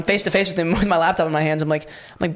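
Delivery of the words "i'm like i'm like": ordinary talk, nothing marked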